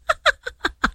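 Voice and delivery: high sudden laugh; burst of laughter. Real, not acted. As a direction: crack up at the staff. Caught by the studio staff.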